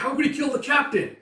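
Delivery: high, loud disbelieving shout; stunned soldier; shocked cry. Acted. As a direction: cry out in horror.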